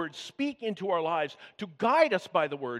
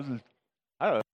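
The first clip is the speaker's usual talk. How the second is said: ordinary talk, nothing marked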